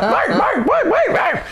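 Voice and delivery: very high squawky imitation of shock; voice going up. Boss shocked at finding Mark, high squawking. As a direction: shoot the pitch right up, clucking. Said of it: "And his voice goes up".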